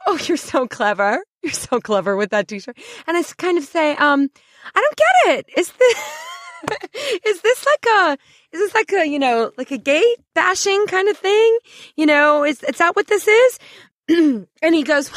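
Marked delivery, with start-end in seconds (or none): 0.1-2.8 fake laughing praise. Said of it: "I'm kind of laughing as if". 4.7-13.6 mock innocent questions. Needling the bully with fake-innocent questions.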